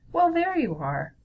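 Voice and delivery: high, lifted hello from a mother; motherly greeting. A narrator's reading. Mother greets her son at lunchtime, high lifted greeting. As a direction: greet him with a high, swooping pitch.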